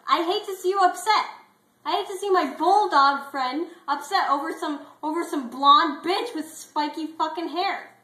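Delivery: high, loud, heated buddy advice; loud pep talk. Acted. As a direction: fire up a friend to let it go. Clifford calms friend over whiskey.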